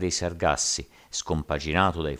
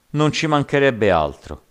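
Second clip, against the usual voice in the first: loud, high-pitched exclamation; raised voice with a touch of alarm; uneasy exclamation; 9 semitones higher, 8 dB louder than usual. A narrator's reading. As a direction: exclaim it loudly, a little worried. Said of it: "esclamò il capitano un po' inquieto".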